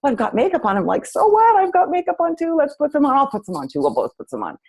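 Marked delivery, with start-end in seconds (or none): 0.0-0.8 high-pitched imitation of a friend protesting; hammed-up high objection; mock protest. Friend's playful protest, retold. 1.0-4.6 very high, loud squeal easing down to normal; hammed-up gleeful overruling; playful insistence. Playfully overruling friend's protest.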